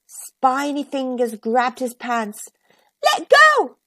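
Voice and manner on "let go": high loud shout. A narrator's reading. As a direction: yell it out. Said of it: "he shouted".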